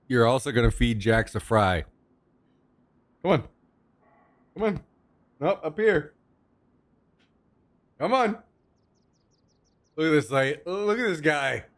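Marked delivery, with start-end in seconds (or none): 3.2-8.4 coaxing the dog. Real, not acted.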